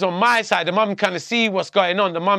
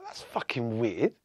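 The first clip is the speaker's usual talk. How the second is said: muttered aside; 7 semitones lower, 10 dB quieter, slower than usual. Retold or imitated. Quotes own muttered reaction aside.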